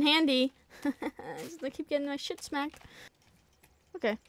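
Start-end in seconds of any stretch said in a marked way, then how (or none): none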